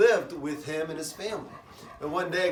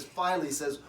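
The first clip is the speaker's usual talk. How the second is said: normal talking voice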